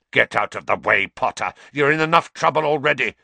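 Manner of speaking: forceful, impatient snarl. A narrator's reading. Snape orders Potter aside, snarling. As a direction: snarl the order, pushing the volume. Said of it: "snarled Snape".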